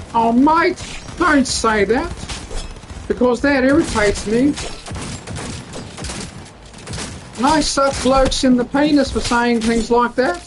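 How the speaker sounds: high put-on politician voice, ranting; Bob Catter impression. Comedian doing his impression on stage, high ranting put-on voice. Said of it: "as soon as I pull out the impressions".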